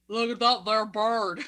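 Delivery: slow, exaggerated Southern drawl; bad Southern accent. Podcaster mimicking a movie character, bad Southern accent. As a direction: draw it out in a clumsy Southern accent. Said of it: "I can't do this accent".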